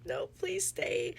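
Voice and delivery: high, drawn-out mock plea; retold hysterical plea. Heroine begs her dying love to stay, retold hysterical.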